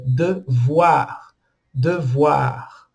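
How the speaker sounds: devoir slowly, with OI said as "wa"; French OI as WA. Shown as a demonstration. Teacher showing learners the French OI sound, slow pronunciation demo. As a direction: draw out devoir as "duh-vwar". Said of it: "fica ua".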